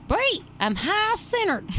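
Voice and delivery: high, slow East Texas twang; East Texas accent. Retold or imitated. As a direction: quote a stuck friend in a high, drawn East Texas drawl. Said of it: "East Texas accent".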